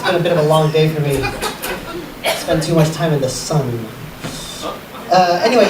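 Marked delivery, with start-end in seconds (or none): none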